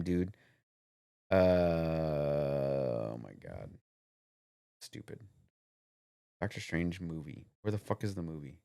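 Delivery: half under his breath, hunting for a name; muttering to himself. Real, not acted. Muttering while searching for the name.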